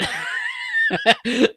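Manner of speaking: high burst of laughter. Real, not acted. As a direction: shriek with laughter. Laughs at the beer clue.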